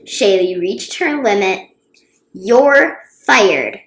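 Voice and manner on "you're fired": firm announcement. A narrator's reading. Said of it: "she announced".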